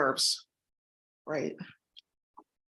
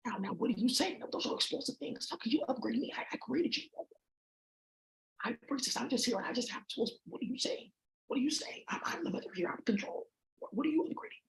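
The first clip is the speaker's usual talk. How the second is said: very soft, half-whispered questioning; hushed protest; 3 semitones higher, 10 dB quieter than usual. Pretend. Mother questioning who is upgrading her, in a hushed half-whisper. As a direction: keep it hushed, almost under the breath. Hushed argument with an unseen creation.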